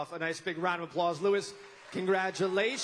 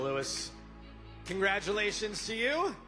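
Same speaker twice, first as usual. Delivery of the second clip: usual speaking voice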